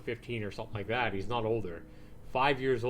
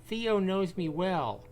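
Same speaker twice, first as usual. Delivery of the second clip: woman neighbor voice; 7 semitones higher than usual. Acted. Neighbor offering to mind the boy, gentle high woman's voice. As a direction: play the kindly neighbor woman, helpful.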